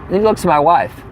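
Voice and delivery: ordinary talk, nothing marked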